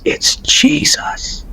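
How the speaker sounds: slow, hushed whisper of awe; whispering it, stunned; awed whisper. Retold or imitated. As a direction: breathe the name in wonder, slowly. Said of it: "all I could say was".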